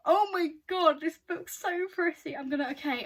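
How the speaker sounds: loud, high burst of delight; overexcited. Real, not acted. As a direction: gush loudly, too thrilled to stay calm. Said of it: "I need to calm down".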